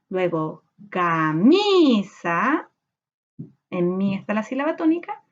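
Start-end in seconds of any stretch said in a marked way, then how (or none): 0.9-2.7 "camisa" very slow with MI drawn out and raised; stressed syllable stretched. Shown as a demonstration. Teacher showing pupils the sílaba tónica, "camisa" with MI drawn out. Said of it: "tenemos que primero separar por sílaba camisa".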